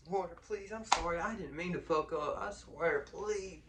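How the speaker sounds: high, whiny begging voice; mock pleading. Scared inmate inwardly begging the guards, high mock pleading. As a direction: beg for mercy, half joking. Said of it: "Real life, I'm over here like".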